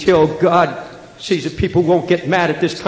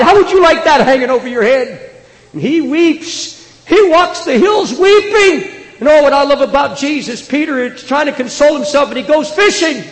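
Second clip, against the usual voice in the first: impassioned high preaching; 9 semitones higher, 5 dB louder than usual. Real, not acted.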